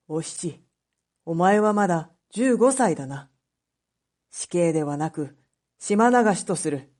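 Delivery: put-on an old judge offering mercy; stern magistrate. Magistrate telling Oshichi she is only fifteen, low and firm.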